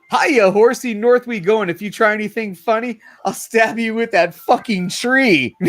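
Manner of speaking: lightly voiced girl, high and cocky; a hint of a tiny bully warning her horse; bossy little girl. Host reads the comic girl ordering her horse, mock high threat. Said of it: "She goes".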